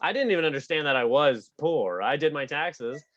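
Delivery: ordinary talk, nothing marked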